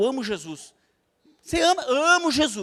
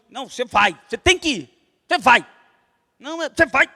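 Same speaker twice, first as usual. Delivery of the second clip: loud, forceful bursts of command; imposing inquisitor; 6 dB louder than usual. Acted. Inquisitor forcing someone to obey, loud imposing mock command. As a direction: hammer each order as a mock inquisitor. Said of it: "Aquele cara que impõe".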